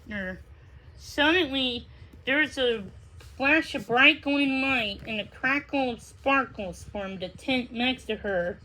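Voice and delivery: usual speaking voice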